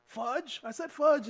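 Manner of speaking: high-pitched, incredulous self-defence; indignant denial. Retold or imitated. Accused student defending his word to the teacher, indignant denial. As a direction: insist on innocence, pitched well up.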